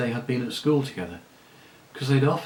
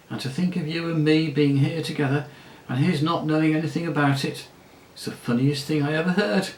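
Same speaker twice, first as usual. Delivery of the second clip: gleeful gossip; 4 semitones higher than usual. Acted. La Saraline tells Aravis her father is in town, gleeful. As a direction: relish the gossip, nearly giggling.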